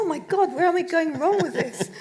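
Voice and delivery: exasperated inner wail, played for laughs; retold dismay. Author relives botched pitch to publicist, mock dismayed. Retells panicked thought during pitch.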